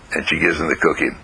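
ordinary delivery, nothing marked

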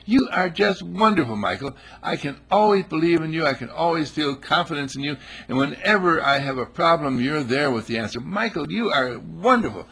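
high, loud, adoring wife voice; imitating the gushing wife. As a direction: coo praise at your husband. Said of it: "wife quoted".

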